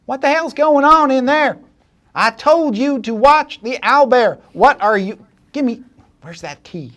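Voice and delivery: very high, loud scolding voice; irate boss demanding the key; angry woman outside. Acted. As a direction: bark orders through the door. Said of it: "a voice outside the door say".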